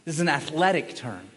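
ordinary delivery

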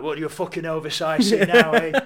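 put-on Boromir taunting in a thick Sheffield accent. Boromir taunting Legolas in a thick Sheffield accent. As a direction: sneer it in broad Sheffield. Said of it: "thick Sheffield accent".

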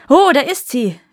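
loud, high exclamation; excited call. A narrator's reading. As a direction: call out the discovery, pitch jumping up. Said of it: "riefen die Kammerdiener". (laut und hoch gerufen)

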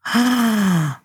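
a high, pleased little gasp; delighted gasp. Acted. Wendy gasping with delight at the gifted cookies. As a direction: gasp as if handed a treasure.

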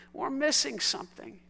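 normal talking voice

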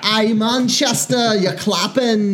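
high, slow hammed-up English accent; shitty English accent. Pretend local complaining about clapping, mock English accent. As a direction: play a bothered Brit, badly. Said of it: "in our shitty English accents".

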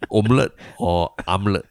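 "omelette" first one way, then the other; two omelettes. Shown as a demonstration. Friend jokingly contrasts the two ways of saying omelette. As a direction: offer both "omelette" sounds mockingly. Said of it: "Do I go to your hum".

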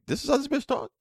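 lightly put-on high mocking voice; mocking Selena's voice. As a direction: pitch up to mimic how she talks. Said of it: "I'm like".

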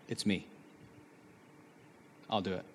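very soft, low and resolved; hushed, spaced-out surrender; quiet self-offering. Retold or imitated. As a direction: offer yourself gently, almost under the breath. Voicing self-sacrificing love.

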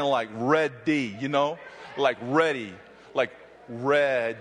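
"ready" low, slow, held on the d; drawn-out ready. Shown as a demonstration. Preacher models how "ready" should sound to the crowd. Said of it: "You know what I'm saying?".

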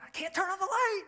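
high-pitched exasperated cry, re-enacted; frustrated outburst. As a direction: blurt it out in mock exasperation. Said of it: "I'm just so frustrated".

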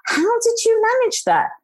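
imitating an astonished husband, high-pitched; amazed husband. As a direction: ask in high, retold amazement. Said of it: "my husband was like".

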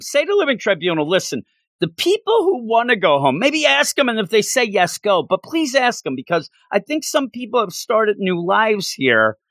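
high, pleading appeal. Retold or imitated. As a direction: beg them to ask first. Pleading to Tony in comic.

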